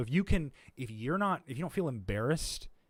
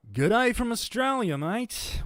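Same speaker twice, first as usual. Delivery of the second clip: loud, high hammed-up Australian accent; 12 semitones higher, 9 dB louder than usual. Streamer shows off the accent card to chat, in a broad Australian accent. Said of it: "the Australian accent card".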